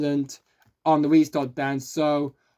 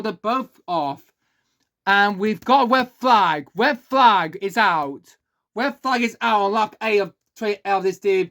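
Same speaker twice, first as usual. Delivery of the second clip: excited, higher race call over a crash; motorsport commentator; 4 semitones higher, 3 dB louder than usual. Acted. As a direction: call the red flag like a live broadcast. Live race play-by-play, red flag.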